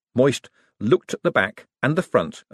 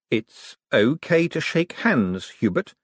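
slow and careful, coaxing; 6 semitones higher, slower than usual. Acted. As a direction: ease him gently to shake hands, slowly. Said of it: "said Moist carefully".

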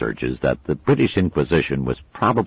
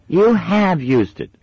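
put-on high, loud indignant child; a little sister catching her out; snapped; 7 dB louder, slower than usual. A narrator's reading. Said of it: "snapped her sister".

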